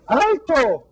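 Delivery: high shouted stop; old man's high shout. A narrator's reading. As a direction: call out sharply after him. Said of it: "gritó el viejito".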